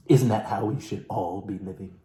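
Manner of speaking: ordinary talk, nothing marked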